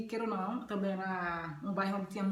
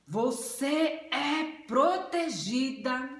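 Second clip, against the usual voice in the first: loud, higher, drawn-out proclamation; strong, authoritative voice of God; 4 semitones higher, 5 dB louder, much slower than usual. Retold or imitated. God assuring a frightened woman she is protected, strong and authoritative. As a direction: proclaim each word slowly with booming authority. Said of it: "forte, com uma voz, com uma autoridade".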